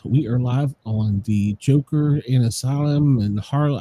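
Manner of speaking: usual speaking voice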